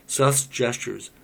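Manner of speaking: usual speaking voice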